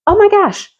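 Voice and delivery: high startled oh my gosh; high sudden surprise; 1 surprised gasp. Real, not acted. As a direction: react to an unexpected find. Sudden realisation.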